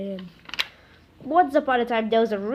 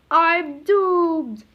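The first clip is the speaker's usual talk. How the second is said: loud, high hammed-up wail; melodramatic despair; 7 semitones higher, 6 dB louder than usual. Powerless Captain Underpants crying out, loud and hammy. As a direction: wail it like a doomed cartoon hero.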